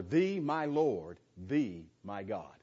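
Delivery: slow, with "Thee" and "thee" drawn out; stress on "Thee". Shown as a demonstration. Teacher showing the original wording, stressed "Thee". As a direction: lean on each "Thee" before "my". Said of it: "We just don't put it there in the English".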